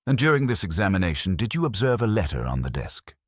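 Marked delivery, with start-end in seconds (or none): none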